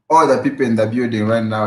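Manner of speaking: normal talking voice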